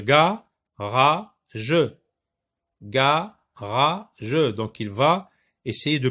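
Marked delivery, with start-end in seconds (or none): 0.0-4.5 ga, ra, je said as separate beats, a bit louder; syllable by syllable. Shown as a demonstration. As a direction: model the three syllables for the class twice. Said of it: "On va dire".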